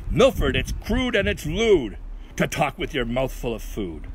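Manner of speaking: emphatic, lifted mock scolding; scolding mother. Mother telling Milford off for his manners, mock scolding. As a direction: lightly voice a lecturing mother. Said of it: "His mother said".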